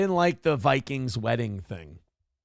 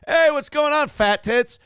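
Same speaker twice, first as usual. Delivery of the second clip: higher and louder, a rowdy buddy hollering hello; mock bro greeting; 9 semitones higher, 5 dB louder than usual. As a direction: toss it out like a loud teasing pal. Friends' jokey crude greeting.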